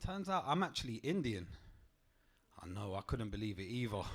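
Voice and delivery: usual speaking voice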